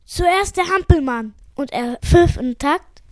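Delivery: usual speaking voice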